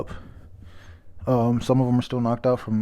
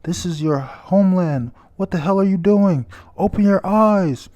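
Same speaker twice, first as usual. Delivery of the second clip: high, loud furious scream; 8 semitones higher, 5 dB louder than usual. Retold or imitated. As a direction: yell at them to wake up, much higher and louder. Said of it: "As he screams".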